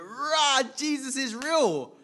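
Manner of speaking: loud high elated exclaim, retold; ecstatic cry. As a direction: shout it like he just met Jesus. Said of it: "And I'm like".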